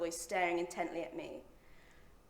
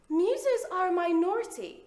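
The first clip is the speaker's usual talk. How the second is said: mock indignation; 9 semitones higher, 6 dB louder than usual. Mock-indignant claim of rights.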